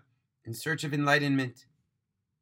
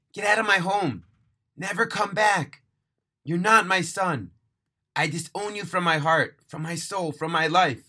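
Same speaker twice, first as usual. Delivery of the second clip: disown him at the top of your voice; furious screaming; 5 semitones higher, 5 dB louder than usual. A narrator's reading. Father disowning his son, screaming in rage. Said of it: "he screamed at Joey".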